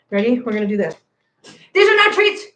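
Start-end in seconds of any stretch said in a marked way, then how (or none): 1.7-2.4 loud, high scolding of the cats; 1 scolding shout. Real, not acted. Streamer scolding her cats away from the treats, loud and high. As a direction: snap at the cats to back off.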